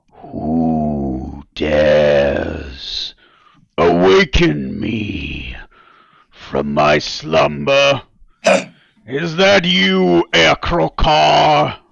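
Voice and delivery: red dragon voice. Acted. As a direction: speak slowly like a roused dragon. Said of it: "you recognize the sounds of a red dragon".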